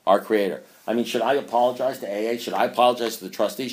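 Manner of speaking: normal talking voice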